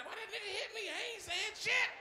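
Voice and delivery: a beaten man whining high and shouting; high whiny victim. Retold or imitated. Beaten man whines to the comic, high then yelling. Victim of his fight complaining.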